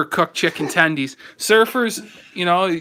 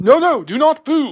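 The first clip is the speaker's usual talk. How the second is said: mock English politician accent, higher and louder; playful English politician accent, pitched up; Boris accent; 8 semitones higher, 4 dB louder than usual. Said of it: "You do the accent".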